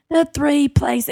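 mock Australian accent, high and stretched; Australian announcer. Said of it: "it was this Australian announcer".

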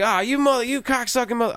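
high, loud yelled insult at a buddy; mock yell. Yelling at a car he thinks is his buddy's, high and loud. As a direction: shout it up high like catching a friend.

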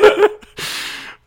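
high, breathy embarrassed giggle; burst of laughter. Real, not acted. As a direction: laugh at your own slip. Corrected about the name.

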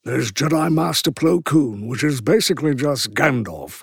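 lightly voiced British Jedi master; a hint of wise old wizard; Plo Koon. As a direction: put on a Gandalf-like British voice. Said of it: "masked character that does this voice".

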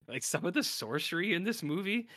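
usual speaking voice

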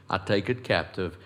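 ordinary delivery

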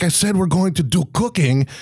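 high-pitched mock-French insistence; exasperated, put-on French accent; insistent French-ish accent. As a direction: spell it out in a fake French accent. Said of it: "what accent I'm doing right now".

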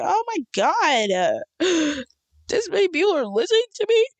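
stunned, can't believe it; shocked disbelief. Retold or imitated. Retells her shocked reaction.

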